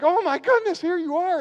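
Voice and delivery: loud, high, thrilled cry; overjoyed. Retold or imitated. Disciples overjoyed to see the risen Lord, loud and thrilled. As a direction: burst out with joy at seeing him. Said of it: "They were like".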